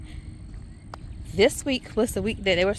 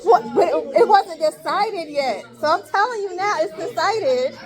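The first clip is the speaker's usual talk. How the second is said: high, emphatic, raised voice; loud defensive protest; 9 semitones higher, 6 dB louder than usual. Real, not acted. Teacher explaining her decision to students, loud defensive protest. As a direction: argue back loudly over the class.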